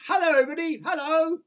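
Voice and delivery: very high, loud happy call; a small boy calling hello to the crowd below; calling out cheerfully. Acted. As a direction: hail the crowd from the rooftop. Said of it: "laughing and waving and calling out".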